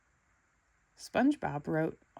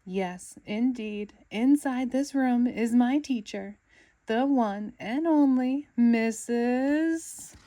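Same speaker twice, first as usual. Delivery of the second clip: slow, louder and higher grand build-up; building excitement; 6 semitones higher, 8 dB louder, slower than usual. Acted.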